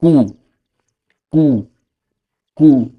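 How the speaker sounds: कू as Spanish "cu"; Spanish letter name. Shown as a demonstration. Teacher to students, careful Spanish letter name. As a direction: repeat कू clearly. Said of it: "क्यू को कैसे pronounce करते हैं".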